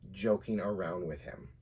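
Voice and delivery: ordinary talk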